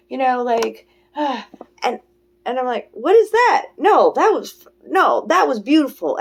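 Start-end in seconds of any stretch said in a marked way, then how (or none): 2.9-6.2 high, loud objection; acted-out emphatic protest; mock self-correction. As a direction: cut in and overrule the panic. Scolds her old panicky energy.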